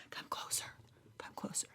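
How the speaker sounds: hushed conspiratorial whisper; breathy teasing whisper to draw listeners close. Pretend. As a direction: lean in and whisper the secret.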